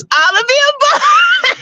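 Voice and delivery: high laugh breaking through. Real, not acted. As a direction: crack up mid line. Laughing joke on answer.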